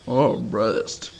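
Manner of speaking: ordinary delivery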